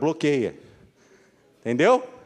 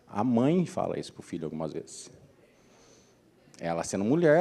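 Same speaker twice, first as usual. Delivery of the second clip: normal talking voice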